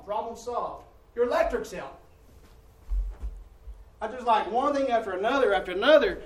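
loud, high preaching of a litany of woes; full-voiced sermon rant; building preacher fervor. Real, not acted. Piling up household disasters, preaching.

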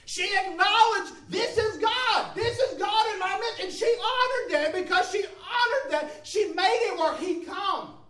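high, loud, driving sermon delivery; impassioned pulpit shout; full-force preaching. Real, not acted. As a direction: preach it out at the top of your range.

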